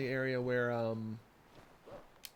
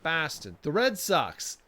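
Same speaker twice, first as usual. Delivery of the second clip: loud, slightly raised Boston accent attempt; 3 semitones higher, 6 dB louder than usual. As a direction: try out a mock Boston accent. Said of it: "Did I get that one right?".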